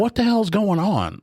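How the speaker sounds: high, slow alarmed exclamation; alarmed what the hell. Retold or imitated. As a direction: act out your own startled alarm. Retells own alarm at wife.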